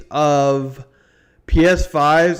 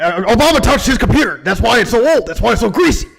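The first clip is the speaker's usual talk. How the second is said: higher-pitched mock conspiracy rant; Alex Jones; 4 semitones higher, faster than usual. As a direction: insist on it like an alarmist host. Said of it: "it does sound a lot like him".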